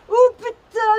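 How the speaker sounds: sudden loud high shout. Real, not acted. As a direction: blurt it out loudly. Freezing in the wind.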